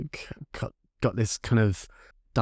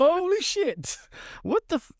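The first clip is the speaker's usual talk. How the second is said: shocked disbelief. Retold or imitated. Bar staff shocked at question.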